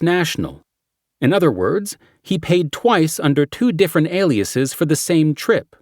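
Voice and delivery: brief the team like a cop; briefing detective voice. Acted. Character briefing quote.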